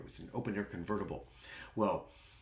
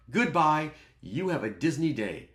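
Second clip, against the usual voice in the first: mock-cheery send-off; 6 semitones higher, 7 dB louder than usual. Retold curt send-off of rude guests.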